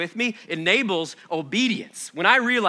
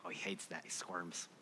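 quiet amused aside; 10 semitones lower, 19 dB quieter than usual. Real, not acted. Aside about son.

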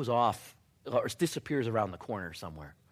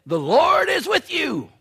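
high, slow, loud heavenly declaration; a booming angel greeting; angel's proclamation; 13 semitones higher, 9 dB louder, slower than usual. Acted.

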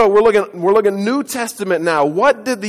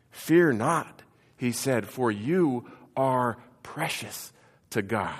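soft, hushed reassurance; quiet comfort to a frightened man; tender, soft-spoken fear not; gentle reassurance; 6 semitones lower, 8 dB quieter, slower than usual. Retold or imitated. Quoting the gentle angel.

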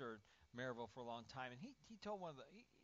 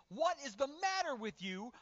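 high, furious parental shout; angry screaming; 12 semitones higher, 13 dB louder than usual. Acted. Parent screaming at a child who fell down, furious shout. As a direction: scream impatiently at a child, hammed up. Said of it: "you scream at them".

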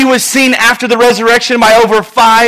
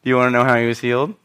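low, quick, softer taunt rising at the end; sarcastic; 8 semitones lower, 6 dB quieter, much faster than usual. Acted. Peter asks the priests, sarcastically. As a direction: needle them with the question. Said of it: "I believe that Peter had some sarcasm in him".

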